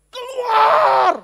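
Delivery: furious high-pitched shout, word drawn out; garang. Acted. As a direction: bellow it like an angry eviction. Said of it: "dengan emosi garangnya terus bilang". (teriakan garang penuh emosi)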